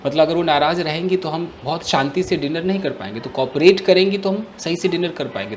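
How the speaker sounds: ordinary delivery, nothing marked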